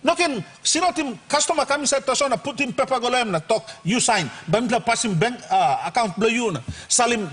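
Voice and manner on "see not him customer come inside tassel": ordinary delivery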